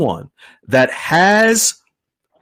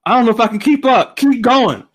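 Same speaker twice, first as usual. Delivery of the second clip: excited; 7 semitones higher, 4 dB louder than usual. Real, not acted. Host overwhelmed by raised hands.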